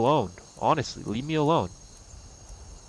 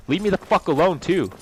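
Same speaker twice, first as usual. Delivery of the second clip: raised, angry demand to be left alone; 6 semitones higher, 3 dB louder than usual. Real, not acted. Ranting at critics, angry. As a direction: snap it out, fed up and loud.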